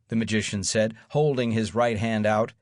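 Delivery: usual speaking voice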